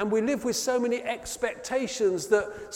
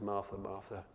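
gentle rebuke; 9 semitones lower, 9 dB quieter than usual. Acted. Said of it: "Jesus looks up at Martha and says".

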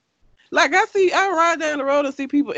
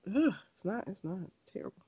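fading groan; 2 semitones lower, 14 dB quieter than usual. Real, not acted. Fading disgusted complaint.

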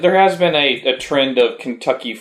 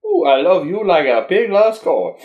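put-on high, hammy Cajun accent; 7 semitones higher than usual. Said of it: "terrible Cajun accent".

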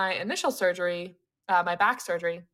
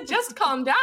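laughing mock advice; 6 semitones higher, 4 dB louder than usual. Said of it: "you should have become a psychotherapist".

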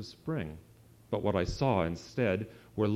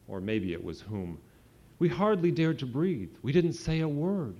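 usual speaking voice

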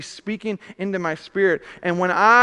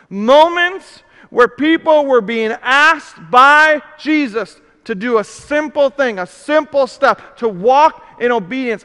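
loud, slow, ringing preacher cadence; rising revival preaching; preaching at full force; 6 dB louder, slower than usual. Acted. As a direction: proclaim each scripture command to the room.

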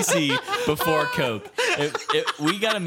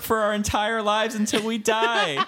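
pitched-up excited ant; high ant voice; 7 semitones higher than usual. Retold or imitated. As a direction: call out like a thrilled ant. Ant voice continues.

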